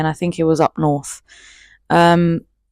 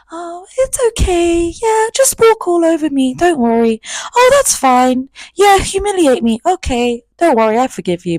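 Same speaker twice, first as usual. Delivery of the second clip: much higher, mocking sweet girly voice; hammed-up high pushover voice; sarcastic naive girl; 11 semitones higher than usual. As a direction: play the doormat girl with heavy irony.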